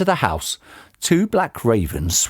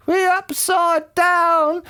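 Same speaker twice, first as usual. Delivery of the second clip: very high, drawn-out wail; panicked scream; much slower than usual. A narrator's reading. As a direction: shriek it slowly in panic. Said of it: "screamed Missus Twit".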